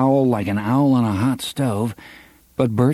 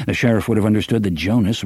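plain reading voice

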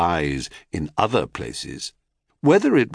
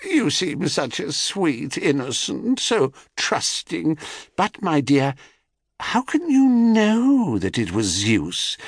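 crone voice; 7 semitones higher than usual. Acted. Crone sowing doubt.

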